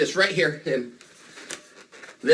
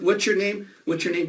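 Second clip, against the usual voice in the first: ordinary talk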